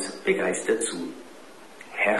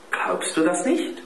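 raised, far-off playful voice; teasing question; 10 semitones higher than usual. A narrator's reading. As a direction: tease him with a light high question. Mutter fragt neckend. (neckende hohe Frage)

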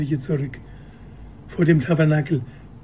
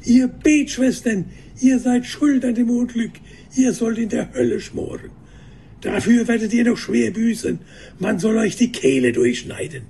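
high-pitched, accusing tirade; narrated crowd's indignant condemnation; angry cursing; 6 semitones higher than usual. A narrator's reading. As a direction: curse them in a raised voice. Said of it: "die Nonnen zu verfluchen".

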